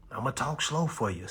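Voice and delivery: ordinary talk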